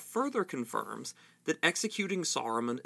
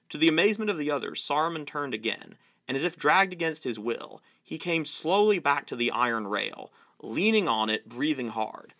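usual speaking voice

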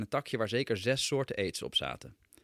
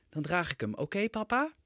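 put-on much higher, sweet pleading child's voice rising at the end; endearing little boy; 8 semitones higher than usual. Son coaxing his father to bring the dinosaur, sweet high plea. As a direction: melt the listener like a coaxing toddler. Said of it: "op die liefdwingende manier van hem".

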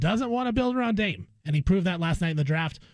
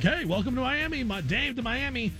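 excited, higher-pitched mock welcome; commenters' hype greeting; 6 semitones higher than usual. Host mimicking online comments about Miami, excited mock greeting. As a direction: imitate eager commenters cheering him into town. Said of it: "All the comments are like".

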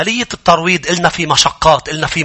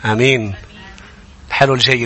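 ordinary talk

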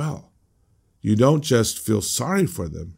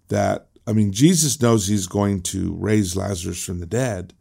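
normal talking voice

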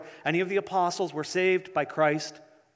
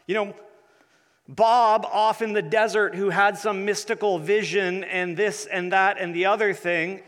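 preaching; 4 semitones higher, 3 dB louder than usual. Acted. Emphatic list of negations.

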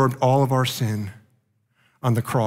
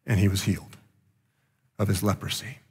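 normal talking voice

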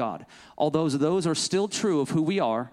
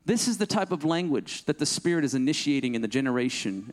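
ordinary talk

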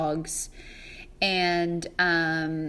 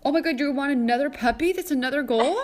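fast, higher, louder disbelief; surprised; 7 semitones higher, 4 dB louder, faster than usual. Real, not acted. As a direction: rush out the astonished question. Friend reveals puppy plan.

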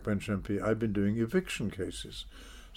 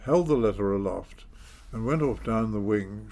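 ordinary talk

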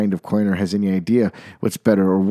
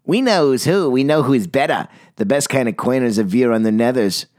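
higher crowing chant; mock shout; 4 semitones higher than usual. Snooty coiners parading and shouting, proud. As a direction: crow it out proudly. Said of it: "they let out a shout".

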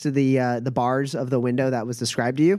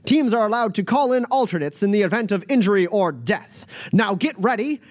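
booming announcer; 10 semitones higher than usual. Acted. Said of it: "booming voice".

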